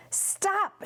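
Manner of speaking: high urgent cry of stop; shouted stop. A narrator's reading. Grandpa Joe shouts at Wonka to stop, high. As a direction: call out to halt him. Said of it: "shouted Grandpa Joe".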